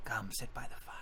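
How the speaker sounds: soft, unvoiced whisper; whispery invitation. Lady Eufema inviting Tarhun to the fire, whispering. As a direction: invite them closer in a whisper.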